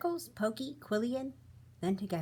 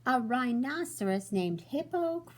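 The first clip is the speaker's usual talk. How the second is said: higher, louder delighted cry; cried out; 6 semitones higher, 5 dB louder than usual. Acted. Fluffy laughing at the rhino's name, excited cry. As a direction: shout out the joke in delight. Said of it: "Fluffy cried".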